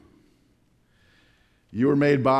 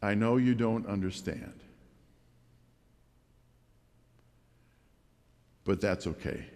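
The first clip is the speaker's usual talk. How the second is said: reassuring, hushed and unhurried; gentle; 7 semitones lower, 6 dB quieter than usual. Retold or imitated. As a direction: say it kindly to someone who doesn't understand. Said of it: "if this expression is said gently".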